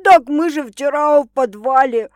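stunned man's outburst; shocked. A narrator's reading. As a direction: blurt it as the news sinks in. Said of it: "шокированно мычал он".

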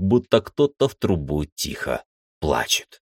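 usual speaking voice

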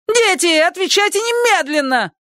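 put-on very high shrieking old lady; shrieking neighbour. A narrator's reading. Neighbour screeches at the children, very high voice. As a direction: screech the question. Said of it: "neighbour demands answers".

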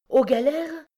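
very high, rising cry of disbelief; shocked exclamation. A narrator's reading. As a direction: cry it out as a stunned question. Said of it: "s'écria Miette". (exclamation stupéfaite)

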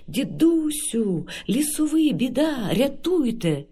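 high, urgent call, slow at first; cry for help. Acted. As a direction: call out to the forest elder in alarm. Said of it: "і кричить". (схвильований крик про допомогу)

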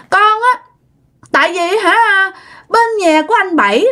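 put-on high little-child voice; toddler voice. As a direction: talk like a two-year-old telling tales. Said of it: "giống như đứa con nít mà hai ba tuổi".

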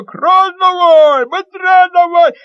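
high, loud, slow urging; eager shout. Acted. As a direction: keep egging him on. Boy keeps urging.

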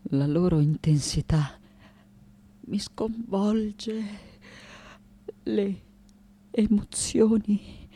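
slow, soft, halting confession with long pauses; hushed, overwhelmed. Acted. Artist confessing that emotions overwhelm her, hushed and halting. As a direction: barely get each word out, slowly.

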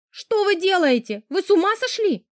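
high-pitched, appalled outcry; alarmed cry. A narrator's reading. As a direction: cry out in disbelief, voice jumping high. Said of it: "прокричала я".

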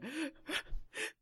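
theatrical mock gasp; hammed-up dramatic gasp. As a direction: gasp like a weepy vampire actor. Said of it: "crying and going".

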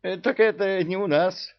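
high emir voice. Acted. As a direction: answer up high and evasive. Emir answers dodging.

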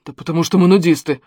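usual speaking voice

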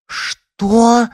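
very high, loud shriek of outrage; sudden yell. A narrator's reading. Alyona learns her amulet is lost, yelling. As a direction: yell it out at the top of the pitch. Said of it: "завопила вдруг Алёна".

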